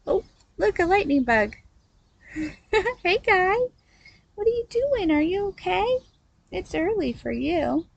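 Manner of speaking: high, sing-song pet talk; talking to a bug. Real, not acted. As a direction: coo at the little bug. Greeting a lightning bug.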